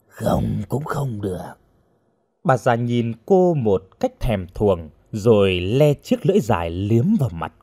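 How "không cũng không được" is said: slow, hushed witch voice; menacing witch. A narrator's reading. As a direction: draw it out softly as a threat. Witch answers the frightened girl. (giọng phù thủy thì thào đe dọa)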